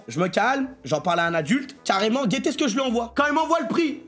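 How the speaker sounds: usual speaking voice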